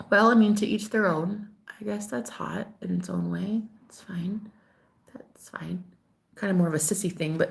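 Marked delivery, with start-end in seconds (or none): 1.8-5.8 soft whisper, much softer; whispered aside. Real, not acted. Aside under her breath.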